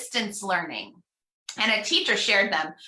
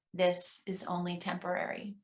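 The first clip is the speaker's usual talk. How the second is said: much softer repeat; soft responsive echo; 4 semitones lower, 10 dB quieter than usual. Shown as a demonstration. Minister softly echoing the affirmation with the congregation. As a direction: barely voice it as an echo. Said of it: "pause and encourage you at home".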